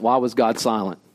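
ordinary talk, nothing marked

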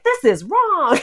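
high-pitched mock indignation, laughing after; indignant outburst. Activist retelling the moment it catalyzed her, mock indignant. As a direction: exclaim it high, half-laughing. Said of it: "I was like".

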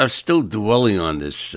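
usual speaking voice

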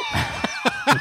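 high, helpless laugh; real laughter. Real, not acted. Friend cracking up at a friend's mispronunciation, high laughter. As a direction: burst out in high-pitched laughing.

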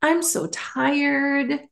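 imitated lingering, stately delivery; Oprah. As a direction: draw the words out, lifted in pitch. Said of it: "how she speaks".

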